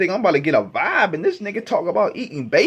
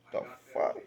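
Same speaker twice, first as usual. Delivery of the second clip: breathy aghast whisper; stunned whisper; 14 dB quieter than usual. Retold or imitated. Shocked by the lyric, he whispers in disbelief.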